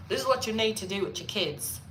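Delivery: brisk mock British accent; British TV nanny. Nanny instructing parents, brisk mock British accent. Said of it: "So she comes in and she's like".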